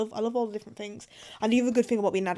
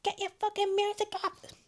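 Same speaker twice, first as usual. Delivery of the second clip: higher, lightly voiced mum snapping; a hint of an annoyed mum; mum telling off; 9 semitones higher, 4 dB quieter than usual. Mum shouting upstairs at her daughter, lightly voiced and higher. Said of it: "Mum's not gonna come upstairs and be like".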